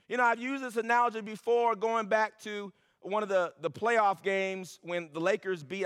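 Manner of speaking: usual speaking voice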